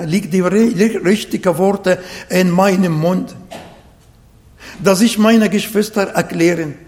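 ordinary talk

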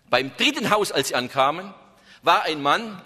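normal talking voice

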